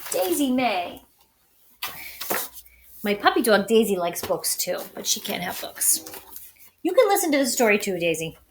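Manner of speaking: usual speaking voice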